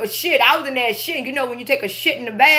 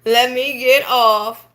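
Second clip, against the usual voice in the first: laughing; 3 semitones lower, much slower than usual. Real, not acted.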